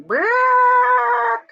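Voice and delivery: high, loud squawked bark; imitated chicken squawk. Retelling the hen's alarm call, high loud mock squawk. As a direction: squawk it like an angry hen. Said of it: "the one chicken starts going".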